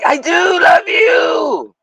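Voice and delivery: hammed-up movie duel. Streamer and Peter in a mock sci-fi duel, high shouting. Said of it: "And I'm like".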